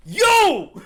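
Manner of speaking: high, very loud angry call; explosive high-pitched shout; snapping shout. Acted. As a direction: yell to confront him. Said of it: "snapping, finally pointing at him".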